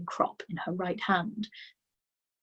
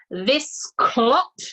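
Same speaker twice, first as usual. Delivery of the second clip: loud, higher booming insult; boomed; 5 semitones higher, 8 dB louder than usual. A narrator's reading. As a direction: boom it over the hall. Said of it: "boomed the headmistress".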